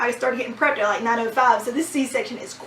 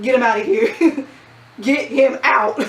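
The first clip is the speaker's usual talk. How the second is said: louder, urgent get-him-out order; panicked order; 6 dB louder than usual. Retold or imitated. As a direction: throw him out, half laughing. Freaking out, sending dad away.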